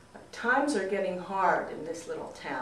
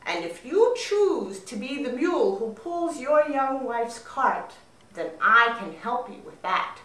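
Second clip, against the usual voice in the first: a louder, otherworldly rook; talking rook; 6 dB louder than usual. Acted. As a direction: speak as a strange, proud bird. Rook speaks to young man.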